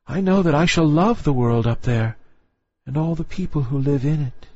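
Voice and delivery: lightly voiced dreamy young girl; young mermaid. A narrator's reading. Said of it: "said she".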